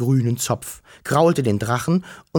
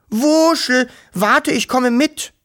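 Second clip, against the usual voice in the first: very high, loud, slow call; shouting; 12 semitones higher, 5 dB louder, slower than usual. Acted. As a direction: shout it after him across the distance. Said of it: "schrie Wischel".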